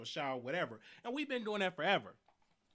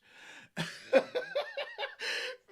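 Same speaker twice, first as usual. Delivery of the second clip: high laugh bursting out; 3 dB louder than usual. Real, not acted. Laughing at the roommate drama. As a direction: let a high laugh burst out mid-story. Laughing at own retold story.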